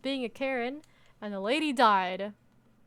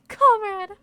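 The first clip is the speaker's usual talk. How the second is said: much higher, much louder "Comrade!" through a laugh; laughing mock shout; 9 semitones higher, 12 dB louder than usual. Real, not acted. Streamer mocking the visitor's word, laughing shout. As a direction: fling the word back with glee.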